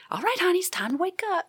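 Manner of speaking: high, sugary wake-up call to a patient; sweet Southern nurse. Retold or imitated. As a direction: coo her awake like a kind Southern nurse. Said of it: "this nice Southern woman said".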